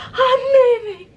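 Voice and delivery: high mock wail through fake sobs; hammed-up crying exit; mock bawling. Sister-in-law leaves bawling, high mock crying wail. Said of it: "He's like".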